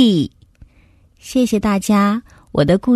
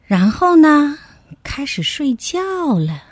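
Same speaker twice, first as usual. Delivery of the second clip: ordinary delivery, nothing marked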